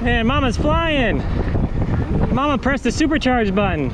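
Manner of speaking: impressed. Real, not acted. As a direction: cheer her on, amazed. Wife riding fast.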